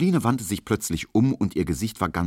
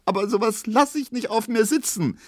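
very high, tearful indignation; wailed, indignant protest; sobbing exclamation. A narrator's reading. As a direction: cry out defiantly through acted tears. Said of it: "rief jetzt Pauline schluchzend".

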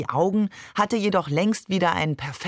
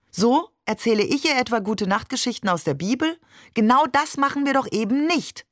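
sharp, higher indignant pushback, rushing the middle; a wife bristling at her husband's claim; angry retort; 6 semitones higher, faster than usual. Acted. Claire argues with Barbe. (empört und gereizt)

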